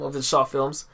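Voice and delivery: normal talking voice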